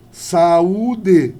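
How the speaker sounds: SAÚDE split loudly as SA-Ú-DE; syllables separated. Shown as a demonstration. Teacher showing a hiatus, SAÚDE loud in separated syllables.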